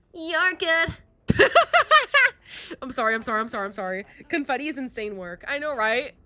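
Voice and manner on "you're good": high, loud shriek of laughter. Real, not acted. To chat, a loud shrieking laugh. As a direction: burst out laughing.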